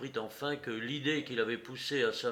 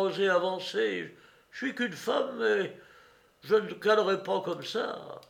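high, louder sneering retort; mocking, defiant woman; 7 semitones higher, 6 dB louder than usual. A narrator's reading. Woman alone dares cowardly Courrapier, mocking. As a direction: taunt him for his cowardice.

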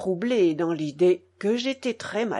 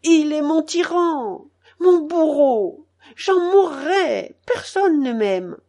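slow, higher, tearful words forced out; struggling to speak; 7 semitones higher, 6 dB louder, slower than usual. A narrator's reading. Boy gasping out his grief to his father, barely able to speak. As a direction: barely get each word out through sobs. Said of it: "Je prononçai ces mots à grand-peine".

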